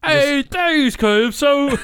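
loud, high, grossly exaggerated mock British; awful British accent. Shown as a demonstration. Reader showing co-host how to do it worse, awful British accent. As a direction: bellow it in absurdly thick British. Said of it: "do it even th-, even worse".

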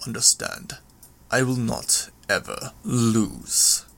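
restrained villain voice, broken into deliberate phrases; understated defiance from a proud brute; proud, unyielding villain. Acted.

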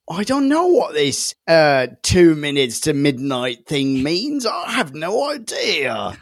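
hammed-up bafflement, slow and drawn out; clueless Greg. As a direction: play a baffled ordinary bloke, stretching the words. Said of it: "what about Greg, who's just there".

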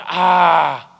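loud, high, exasperated "ah"; mock exasperated yell. Yelling at yourself.

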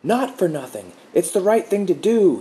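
high, loud strained squawk. A narrator's reading. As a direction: let the voice crack upward. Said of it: "he squawked".

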